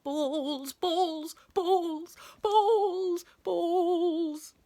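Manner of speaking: high, crazed drawn-out chant; dazed, loopy repetition; gone bananas. A narrator's reading. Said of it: "as if he'd gone bananas".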